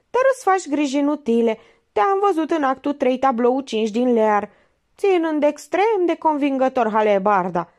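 high, pressing detective voice; Minerva interrogating. A narrator's reading. Minerva grills the suspect actor, high and pressing.

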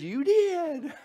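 very high, loud wisecrack through a laugh; high laughing comeback; laughing reply. Acted. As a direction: toss it back as a cheeky punchline. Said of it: "the dude looks over and he says".

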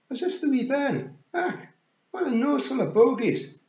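very high Scots pictsie; Rob Anybody. Acted. Rob looking over the snotty bairn, high Scots voice. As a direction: exclaim in loud, squawky Scots. Rob inspects little Wentworth.

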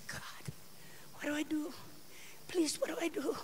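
soft, pleading prayer to God, barely voiced; quietly begging God for an answer; hushed desperate prayer. Preacher retelling her desperate prayer, hushed and pleading.

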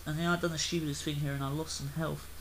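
ordinary talk

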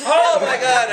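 high, loud starstruck gasp; fanboy gasp. Retold or imitated. Fan meeting his idol, high loud gasp. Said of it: "I just totally fanboyed".

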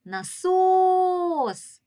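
насос called out loud with a long, high second syllable; calling out the word. Shown as a demonstration. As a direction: stretch and lift the СОС of насос. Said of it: "погукаємо слово насос".

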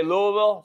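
Louisville with an uh in the middle, LOO-uh-vill; Louisville as LOO-uh-vul. Shown as a demonstration. Host listing how viewers say the city, Louisville as LOO-uh-vill. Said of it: "Some of you say".

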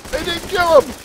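sudden high outcry; shocked outcry. Real, not acted. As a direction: cry out in disbelief. Shocked target survived.

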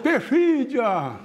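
high, stretched call to the stage; imitated shouted request. Another fan requesting a hit, imitated high holler. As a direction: mimic a fan hollering a song title. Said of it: "Um gritou lá".

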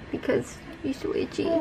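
soft whispered aside; whispering. Real, not acted. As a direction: breathe it out unvoiced.

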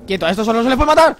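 loud, high, fast warning; high-pitched combat yell; urgent shout. Real, not acted. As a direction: shout it urgently as danger closes in. Werewolf approaching, player attacks.

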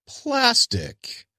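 plastic said way up high, drawn out; mock emphasis. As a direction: plastic sung high and long. Mocks bizarre emphasis on plastic.

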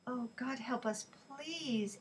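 hushed pleading prayer; silent prayer. A narrator's reading. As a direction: pray it softly under your voice. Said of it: "Ellie prayed silently".

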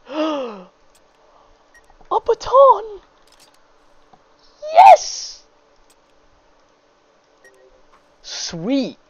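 high thrilled shriek of joy; scared horror game streamer. Real, not acted. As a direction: squeal with delight at the find. Finds melee weapon, thrilled.

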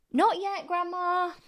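high, loud call to the next room; calling back. Acted. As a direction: call it back through the wall. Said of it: "George called back".

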